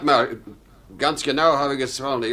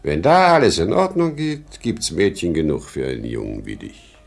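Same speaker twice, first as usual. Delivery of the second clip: ordinary talk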